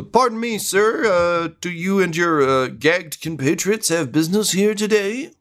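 high, halting guard voice; a flustered guard politely questioning visitors; hesitant gate guard. Acted. Game master plays the gate guard.

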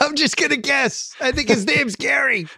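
high mock broadcast voice, same as his partner's; announcer impression. Cohost joins the announcer bit, high mock broadcast voice. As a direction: keep the joke going in a put-on announcer voice. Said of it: "How about Tessitore with this game?".

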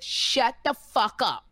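slow, deliberate cold threat; a mild mock of a slow furious warning; cursing him out. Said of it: "I cursed him out so bad".

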